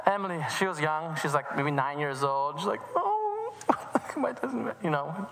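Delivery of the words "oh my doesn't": put-on high, whimpering child voice near tears; upset little girl. Girl upset about her torn dress, whimpering. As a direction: play a small girl wailing over her dress.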